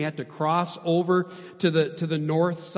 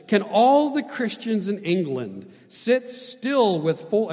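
loud, raised, slow rhetorical question; scolding; 6 semitones higher, 7 dB louder, slower than usual. A narrator's reading. Hudson Taylor to Christians in England, scolding. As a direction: scold them from the pulpit. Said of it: "he scolded".

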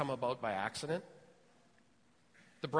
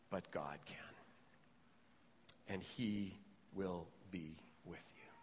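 quiet sincere answer; 8 semitones lower, 7 dB quieter than usual. Pretend. Preacher voices the right answer to a doubter, soft and sincere. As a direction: tell them tenderly they can't, but God can. Voicing the godly, humble answer.

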